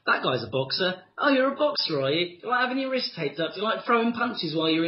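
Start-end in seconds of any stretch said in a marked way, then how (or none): none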